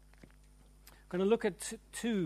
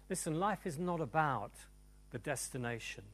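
ordinary delivery

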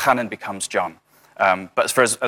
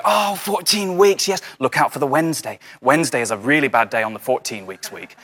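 mock know-it-all parent; 4 semitones higher than usual. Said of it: "they'll go".

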